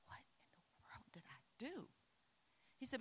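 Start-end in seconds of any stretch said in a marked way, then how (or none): 0.1-1.9 baffled unvoiced whisper. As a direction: breathe it out, stunned and confused. Baffled student in front of teacher.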